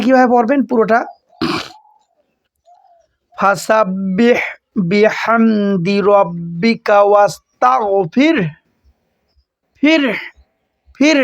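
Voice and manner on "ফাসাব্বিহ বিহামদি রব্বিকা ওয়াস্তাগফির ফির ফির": slow chanted tilawat; Quran recitation. Shown as a demonstration. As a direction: recite the whole phrase slowly. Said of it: "এইভাবে". (ধীর তিলাওয়াত)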